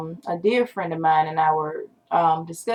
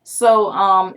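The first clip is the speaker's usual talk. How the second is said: ordinary talk, nothing marked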